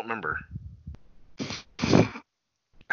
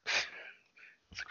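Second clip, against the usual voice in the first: whisper; 15 dB quieter than usual. Real, not acted. Whispered aside after sunburn story.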